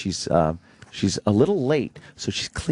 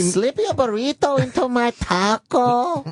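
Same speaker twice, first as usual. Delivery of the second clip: high, stretched-out mock Mexican maid accent; Spanish accent; 11 semitones higher, slower than usual. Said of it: "Can you do a Spanish accent".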